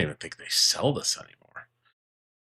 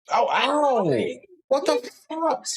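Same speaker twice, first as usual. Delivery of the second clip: sudden high cry of pain; real pained yelp; 14 semitones higher, slower than usual. Real, not acted. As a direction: yelp as the beak catches you. Parrot bites him.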